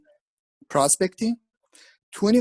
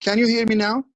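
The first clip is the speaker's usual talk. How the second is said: louder, higher checking question; speaking up; 4 semitones higher, 5 dB louder than usual. Real, not acted. Speaker checking with listeners who asked for more volume, speaking up. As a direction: raise your voice so they can hear. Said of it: "speak louder".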